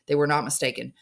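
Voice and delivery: ordinary delivery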